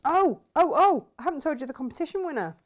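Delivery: suddenly remember big news. Real, not acted. Host remembering to tell viewers, bursting out. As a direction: burst out high and loud, fast.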